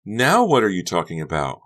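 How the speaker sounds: loud baffled question; ask, baffled, as Honey; bewildered. Acted. Honey is confused by Becca, loud and bewildered.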